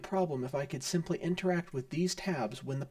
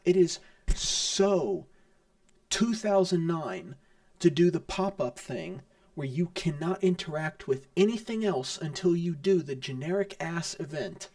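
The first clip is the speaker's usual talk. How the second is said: exasperated rant; 4 dB louder than usual. Real, not acted. Player complaining about outdated pop-up design, exasperated. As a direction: lean hard on each complaint, fed up.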